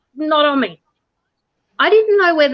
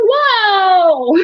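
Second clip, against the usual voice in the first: high startled whoa; 8 semitones higher than usual. Retold or imitated. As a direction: jump back in surprise. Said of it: "he's going".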